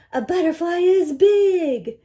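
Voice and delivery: loud, high read of the title; big, booming heading. Acted. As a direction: make the word big sound huge. Reads heading aloud.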